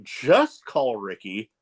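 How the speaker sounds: very slow, deliberate imitated announcer; pointed comeback. Ring announcer points right at heckler, slow pointed slogan. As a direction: stretch each word out, aimed at one person. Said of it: "points right at me".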